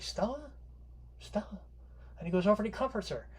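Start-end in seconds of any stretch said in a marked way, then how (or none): 0.0-1.6 gentle call. Retold or imitated. Stanley softening toward crying wife.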